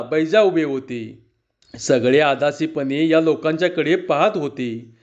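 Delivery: ordinary delivery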